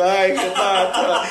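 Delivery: delighted laughing call; laughing exclaim. Real, not acted. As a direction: laugh out her name. Host surprised by guest arrival.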